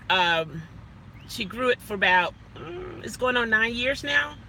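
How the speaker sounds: normal talking voice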